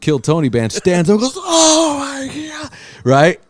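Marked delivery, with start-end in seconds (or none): 1.4-2.7 shocked gasp. Retold or imitated. Drummer shocked on stage.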